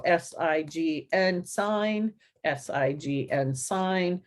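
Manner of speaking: slow, evenly spaced letters, then the word; letter-by-letter spelling. Shown as a demonstration. Teacher showing the tapping routine, slow letter-by-letter spelling. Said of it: "as they say the letter".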